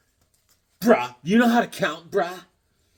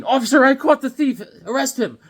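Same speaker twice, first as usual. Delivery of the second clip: put-on high, loud heroic girl voice; Supergirl voice; 8 semitones higher, 6 dB louder than usual. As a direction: call out like a proud heroine. Acting out comic dialogue.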